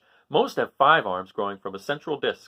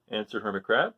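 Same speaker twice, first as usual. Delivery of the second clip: ordinary delivery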